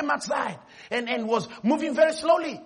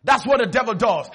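usual speaking voice

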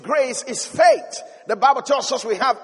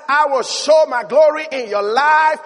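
preaching at full force; 5 semitones higher, 6 dB louder than usual. Acted. Preacher voices God's promise to the faithful, full-force preaching. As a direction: proclaim a divine promise, building to a stretched final word.

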